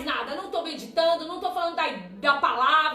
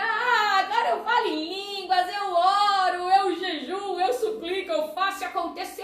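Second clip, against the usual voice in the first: boastful mock bragging. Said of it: "você quer voltar".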